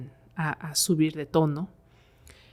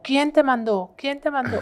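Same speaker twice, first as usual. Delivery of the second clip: high-pitched, insistent interrogation; exorcist's demand; 9 semitones higher, 4 dB louder than usual. Retold or imitated. Priest demands who sent the spirit, insistent raised voice. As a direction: call out the question like an exorcist.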